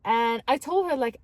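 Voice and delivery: normal talking voice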